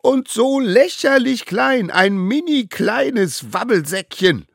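loud, very high jeer; squealing gang insult; roared mockery. A narrator's reading. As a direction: taunt him as tiny, stretching the end. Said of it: "brüllten sie".

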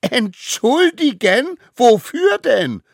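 high, loud and drawn-out disbelief; indignant. Acted. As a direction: act affronted, stretching each word. Accused of wrongdoing, answers back indignantly.

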